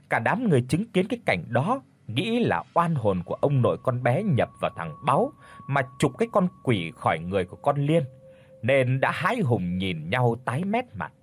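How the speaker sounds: ordinary delivery, nothing marked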